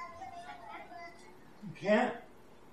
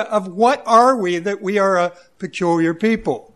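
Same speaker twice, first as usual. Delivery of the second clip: loud, emphatic sermon voice; booming pulpit delivery; full-force preaching; 10 dB louder than usual. Acted. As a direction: preach it to the congregation.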